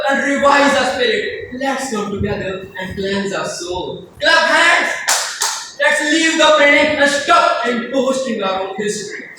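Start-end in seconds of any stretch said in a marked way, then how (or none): 4.2-5.8 raised, emphatic command to a crowd; rallying call. Acted. Poet calling people to clap together, rallying cry. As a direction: call it out high and ringing.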